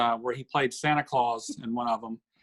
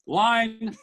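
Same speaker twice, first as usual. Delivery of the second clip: Master Thespian calling for a line; 8 semitones higher, 4 dB louder than usual. Retold or imitated. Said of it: "he goes".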